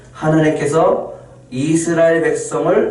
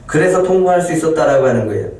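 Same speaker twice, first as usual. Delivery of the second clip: normal talking voice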